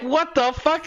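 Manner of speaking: high-pitched mock bewilderment; retold disbelief. Streamer retelling natto on spaghetti, acted disbelief. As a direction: replay being baffled by the dish.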